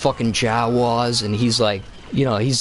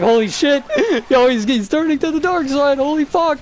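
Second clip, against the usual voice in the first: much higher and louder, laughing in awe; hyped fan reaction; 14 semitones higher, 4 dB louder than usual. Retold or imitated. Viewer watching Anakin turn dark, high laughing hype. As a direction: shout it like watching the big turn. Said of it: "and you're like".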